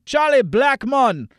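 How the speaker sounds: a light, slow Jamaican accent on "Charlie Blackmon". Shown as a demonstration. Host enjoys saying the player's name, light Jamaican accent. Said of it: "I enjoy saying his name with a Jamaican accent".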